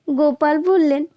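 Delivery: ordinary delivery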